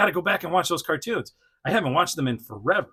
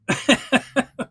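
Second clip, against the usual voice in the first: high giggle; 11 semitones higher, 6 dB louder than usual. Real, not acted. Laughs at toy action.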